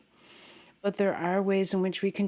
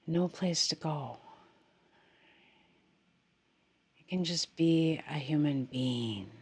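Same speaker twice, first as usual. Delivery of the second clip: softer, unhurried meditation voice with long pauses; guided meditation; 3 semitones lower, 4 dB quieter than usual. Real, not acted. Teacher guiding meditators to settle into the body, guided meditation. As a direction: gently settle them, leaving space after each phrase.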